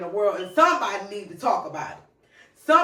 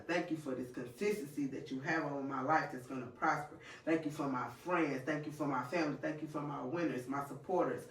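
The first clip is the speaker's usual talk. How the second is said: soft, low prayer voice; quiet prayer; 6 semitones lower, 10 dB quieter than usual. Acted. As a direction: pray it softly and low. Leads a prayer.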